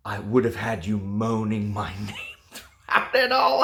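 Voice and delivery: the line cracks into a giggle on name; reading through laughter. Acted. Reviewer failing to read the steamy line, laughing. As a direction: try to read it straight but laugh. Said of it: "Oh, sorry".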